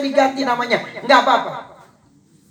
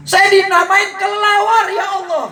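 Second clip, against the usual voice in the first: mock wail; 8 semitones higher than usual. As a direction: wail it like a child who was named a bat. Child wails about bat name.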